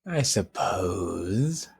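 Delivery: coy tease. Real, not acted. Coy teasing reply.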